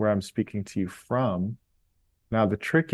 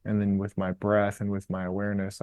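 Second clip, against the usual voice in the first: normal talking voice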